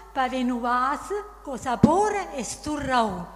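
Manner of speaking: reciting verse in Neapolitan dialect; Neapolitan dialect poem. Acted. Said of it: "Dillo, dai, alza così".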